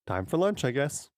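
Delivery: breezy and cheery, like nothing's wrong; cheerful. A narrator's reading. Boy cheerfully greets his parents at lunch, upbeat. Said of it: "said cheerfully".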